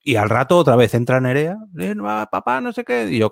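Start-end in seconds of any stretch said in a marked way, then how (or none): 1.8-3.1 put-on much higher, childlike interruption; lightly voiced daughter cutting in; imitating a child. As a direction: pitch up like a kid calling her dad.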